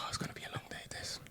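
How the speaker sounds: unvoiced hushed aside; whispering so mum won't hear. Boy whispers to his friend in the bedroom, hushed whisper. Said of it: "And I was like".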